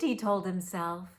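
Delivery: usual speaking voice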